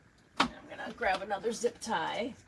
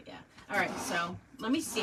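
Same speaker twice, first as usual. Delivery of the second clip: usual speaking voice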